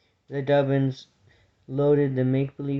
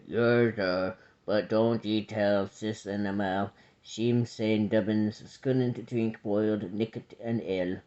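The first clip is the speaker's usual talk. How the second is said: slow, low rustic mole voice; mole babe; 3 semitones lower, slower than usual. A narrator's reading. Mole babe plotting with the abbot, slow deep mole voice. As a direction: drawl it low as a little country mole.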